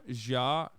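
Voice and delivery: Ja pronounced zha, not ha; Portuguese ja. Shown as a demonstration. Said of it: "not ha".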